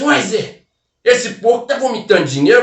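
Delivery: high, excited disbelief; can't believe his eyes; amazed question. Retold or imitated. Barman asking Zé about the money pig, high amazement.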